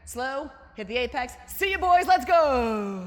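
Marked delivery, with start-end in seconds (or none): 1.5-3.1 high, loud gleeful shout; triumphant yell. Retold or imitated. Racer passing the boys, loud triumphant yell. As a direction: crow it as you pass them.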